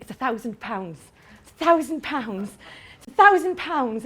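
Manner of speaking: "It's a thousand pounds" repeated slowly, stress on "thousand"; rehearsing the price. Shown as a demonstration. Seller rehearsing the price in their head, slow. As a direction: drill the line firmly. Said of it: "in your head you think".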